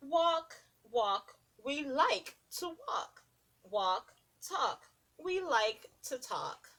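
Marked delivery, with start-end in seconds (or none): none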